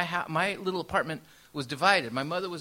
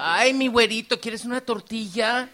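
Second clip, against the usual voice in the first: put-on higher, louder, sweet shopkeeper calling the boy; tortilla lady; 6 semitones higher, 7 dB louder than usual. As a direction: coo it like a friendly Tijuana vendor. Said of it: "They'd be like".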